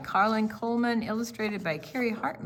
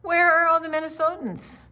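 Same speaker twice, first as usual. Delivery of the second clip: higher and louder, calling the refrain out; excited call; 8 semitones higher, 7 dB louder than usual. Acted. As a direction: sing it out to the room. Refrain called out to kids.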